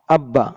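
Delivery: "আব্বা" with the ব held double; "আব্বা" as two joined syllables, ab-ba; doubled consonant. Shown as a demonstration. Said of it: "দুইবার পড়িতে হয়".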